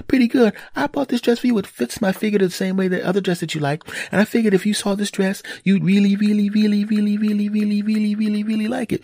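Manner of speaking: high mock wife voice, insistent; imitated wife pressing.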